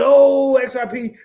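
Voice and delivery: high, loud mock panic over crypto. Streamer mocking panicked buyers, high and loud. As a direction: imitate the nervous buyers freaking out.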